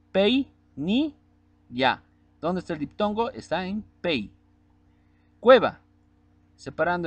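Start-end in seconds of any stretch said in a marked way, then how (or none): 0.1-2.0 "pei, ni, ya" said as three separate beats, "pei" higher; syllable by syllable. Shown as a demonstration. Teacher dividing "pei, ni, ya" into syllables. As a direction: break "pei, ni, ya" into clear beats. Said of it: "Separando en sílabas".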